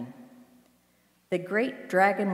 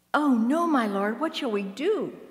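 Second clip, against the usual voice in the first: high, alarmed cry, starting loud; dramatised dismay, pitch soaring; panicked servant; 7 semitones higher, 4 dB louder than usual. A narrator's reading. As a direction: cry out to your master in acted alarm. Frightened servant cries to his master.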